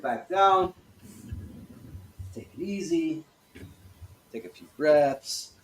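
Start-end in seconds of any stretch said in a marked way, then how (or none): none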